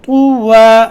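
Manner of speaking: slow, melodic recitation for repetition; Quran recitation. Shown as a demonstration. Teacher reciting first so students read along. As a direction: recite slowly so the class can follow. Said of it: "मैं पढ़ाता हूँ".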